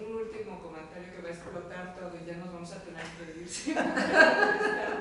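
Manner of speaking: breaking into laughter mid-sentence; words swallowed by a big laugh. Real, not acted. Laughing while trying to close.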